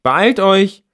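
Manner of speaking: high, loud impatient call; loud high-pitched girl's call. A narrator's reading. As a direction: shout through the door for them to hurry. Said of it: "ruft seine Freundin".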